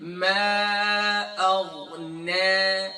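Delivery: "ما اغنی" recited very slowly with a thin ne at the end; long drawn "ما اغنی", nun kept light. Shown as a demonstration. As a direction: Quran teacher elongating "ما اغنی" with a light nun. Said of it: "یہ نون light ہو جائے گا".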